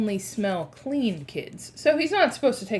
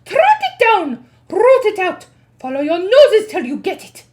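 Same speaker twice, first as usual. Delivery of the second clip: shouting orders; 10 semitones higher, 12 dB louder than usual. Acted. Witch queen barks orders.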